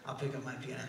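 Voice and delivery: normal talking voice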